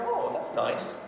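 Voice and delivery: high, mock-touched little reaction; pleased client. Coach voices client hearing she was thought of, pleased. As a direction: imitate someone pleased by a small compliment. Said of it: "it makes her go".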